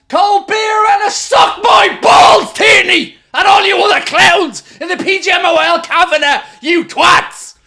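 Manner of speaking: jeering yell, high and loud; taunting shout. Real, not acted. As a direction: scream insults in triumph. Goal celebration taunting rivals.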